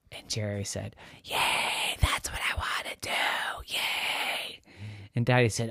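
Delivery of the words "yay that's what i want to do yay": hoarse whisper with no voice; lost voice. Acted. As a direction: try to talk but only air comes out. Jerry voiceless throughout.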